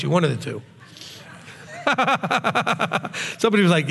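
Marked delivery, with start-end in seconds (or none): 1.8-3.3 laughing. Real, not acted. Laughs at his own joke.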